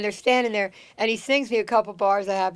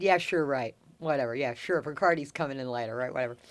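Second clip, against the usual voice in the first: dismissive disbelief; 5 semitones lower, 3 dB quieter than usual. Retold or imitated. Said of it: "And I said".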